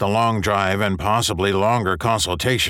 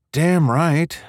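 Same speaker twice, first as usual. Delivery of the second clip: smiling; 8 semitones higher, 3 dB louder than usual. A narrator's reading. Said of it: "he could hear the smile in her voice".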